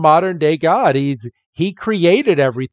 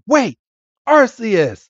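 calling out; 8 semitones higher, slower than usual. Retold or imitated. Jupiter calling out to Arceus.

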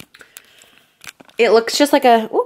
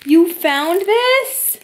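astonished; 8 semitones higher, 3 dB louder, much slower than usual. Real, not acted. Surprised by a skincare gift.

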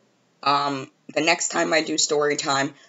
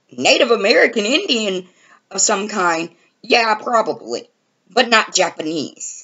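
higher, louder arguing in disbelief; exasperated disbelief; 5 semitones higher, 4 dB louder than usual. Retold or imitated. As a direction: set him straight, fed up. She argues with Justin in disbelief.

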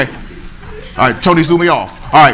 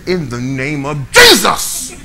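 frustrated declaration; 4 dB louder than usual. Retold or imitated. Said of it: "I was so frustrated".

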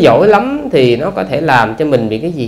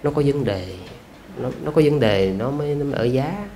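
softly mimicked neighbourhood gossip; imitating gossipy neighbours; 9 dB quieter than usual. Monk retelling neighbours gossiping about an unmarried woman, hushed mock gossip. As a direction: drop the volume like a gossiping neighbour. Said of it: "độc mồm độc miệng mà nói".

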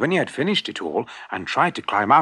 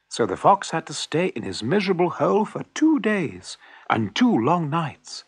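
ordinary delivery, nothing marked

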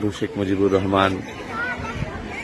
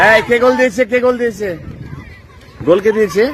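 yelling across the room; 14 semitones higher, 4 dB louder than usual. Real, not acted. Reporter shouting questions at children.

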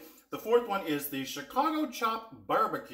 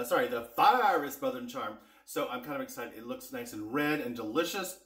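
usual speaking voice

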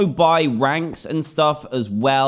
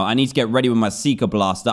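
usual speaking voice

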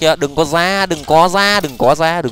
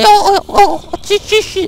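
high frantic yelps; real panicked shrieks; panicked squeal; 5 dB louder, slower than usual. Real, not acted. Panic in fight.

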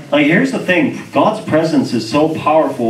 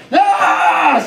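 high drawn-out yell; retold scream, pitched way up; mock scream. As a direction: imitate a man's sudden scream of pain. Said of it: "Starts screaming and grabbing his chest".

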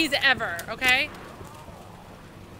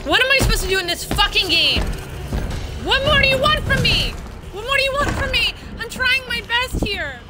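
high, frustrated wail; exasperated pleading; 5 semitones higher than usual. Real, not acted. Player trying their best at the game, exasperated wail.

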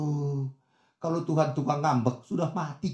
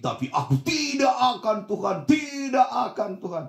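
put-on old-style bombast; 8 semitones higher than usual. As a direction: stretch the refusal like an overacted hero. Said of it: "Ini gaya-gaya zaman lalu ini". (ikrar berlebihan, nada tinggi dipanjangkan)

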